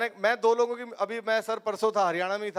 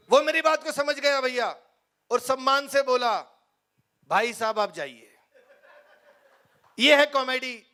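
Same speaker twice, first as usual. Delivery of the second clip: high, loud rally voice; Rahul Gandhi impression; 3 semitones higher, 4 dB louder than usual. Rahul Gandhi retelling the farmer's reply, high and loud. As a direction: keep the raised politician voice going. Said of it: "Rahul Gandhi".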